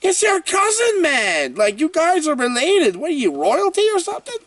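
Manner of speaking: mock-outraged it's your cousin; incredulous. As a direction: ask it in shocked disbelief. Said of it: "And I'm thinking".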